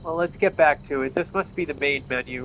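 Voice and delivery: ordinary talk, nothing marked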